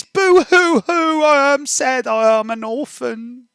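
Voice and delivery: high, slow hammed-up boo-hoo; mock halfling crying. Rogue fake-crying as an orphan to the guard, hammed up. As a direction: play a wailing orphan badly on purpose. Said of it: "I know how they cry".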